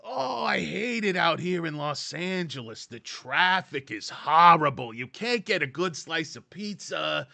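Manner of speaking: high, loud, slow New York whine; mock a homesick New Yorker griping about Los Angeles; exaggerated New York accent moaning about LA; New Yorker complaining. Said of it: "they come out and they go".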